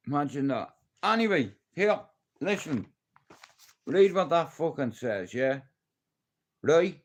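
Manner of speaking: ordinary talk, nothing marked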